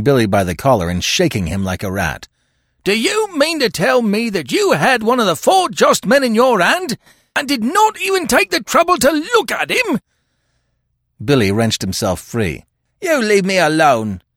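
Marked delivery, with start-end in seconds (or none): 2.8-10.0 high, loud, outraged tirade; furious high-pitched scolding; storming. A narrator's reading. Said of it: "stormed the detective". 13.0-14.2 high, loud defiance; defiant, aggrieved crook. A narrator's reading. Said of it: "he said defiantly".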